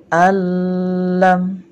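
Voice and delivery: Al lam loud, on one long doubled l; merged reading. Shown as a demonstration. Teacher repeats the correct idgham for pupils, merged l. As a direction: stretch the l in Al lam, no n at all.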